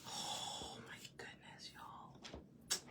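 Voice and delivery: slow, breathy whisper; dismayed whisper. Real, not acted. Painter confiding about the messy good shirt, slow whisper.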